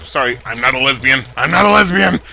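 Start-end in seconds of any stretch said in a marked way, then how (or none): 1.4-2.2 raised-voice protest; emphatic denial. Real, not acted. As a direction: insist on it, punching it out. Same denial repeated emphatically.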